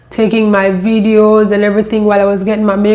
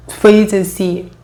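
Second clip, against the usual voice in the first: usual speaking voice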